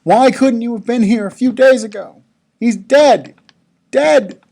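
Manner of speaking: anguished crying plea. A narrator's reading. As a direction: call out in grief like a sister in tears. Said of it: "Martha is crying. She's in tears".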